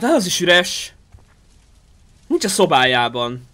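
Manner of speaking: usual speaking voice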